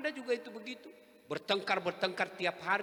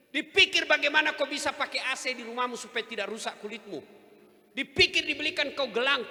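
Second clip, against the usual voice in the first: preaching build; 6 semitones higher, 6 dB louder than usual. Real, not acted. Preacher exhorting wives, raised voice.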